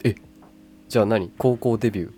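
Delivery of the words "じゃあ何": ordinary delivery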